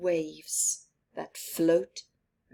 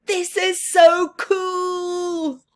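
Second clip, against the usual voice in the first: high, slow, loud delight; thrilled; 11 semitones higher, 7 dB louder, much slower than usual. Acted. Boy watching comet from spacewalk, thrilled. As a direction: savor every word in awe.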